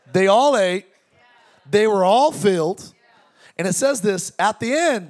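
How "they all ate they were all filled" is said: excited louder preaching. Real, not acted. Preacher builds excitement on miracle.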